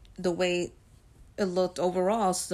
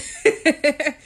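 short laugh; 7 semitones higher, 6 dB louder than usual. Real, not acted. Laughing about son.

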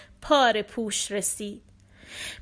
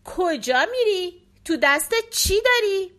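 called out a little higher and louder; a boy yelling across the yard at her; mild shout, higher pitched; shouted question; 6 semitones higher, 3 dB louder than usual. A narrator's reading. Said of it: "یکی از اونا فریاد زد".

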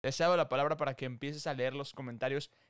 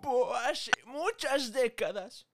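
put-on old man voice; 9 semitones higher, slower than usual. Joins the old man bit.

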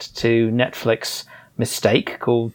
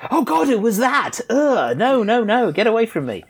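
mock horror; 10 semitones higher than usual. Real, not acted. Critic recoils remembering a dreadful film, mock horror. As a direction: shrink back in mock disgust.

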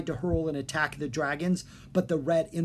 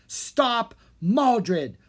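higher, louder group command; 8 semitones higher, 5 dB louder than usual. A narrator's reading. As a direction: order the dragons to stop him. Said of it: "commanded together".

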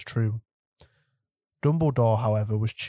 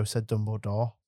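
usual speaking voice

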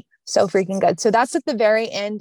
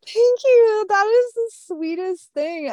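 touched and delighted; 12 semitones higher than usual. Real, not acted. Touched by the host's praise.

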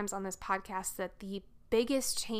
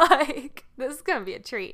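laughing; 6 semitones higher, 13 dB louder than usual. Real, not acted. Sarcastic aside about chatty driver.

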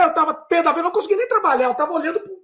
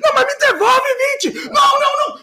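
much higher, frantic yelling; shouting exchange; 10 semitones higher than usual. Retold or imitated. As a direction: shout him down. Discussão gritada reencenada. (gritaria desesperada)

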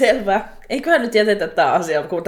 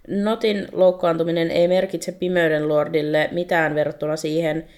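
usual speaking voice

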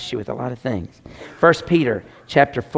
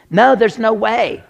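ordinary talk

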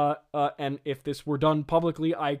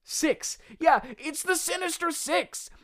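high-pitched comic disbelief; squeaky-high incredulous outburst; exasperated; 13 semitones higher, slower than usual. Real, not acted. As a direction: pitch shoots up, stating the absurdly obvious.

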